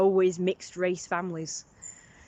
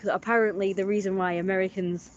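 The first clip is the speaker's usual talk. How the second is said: usual speaking voice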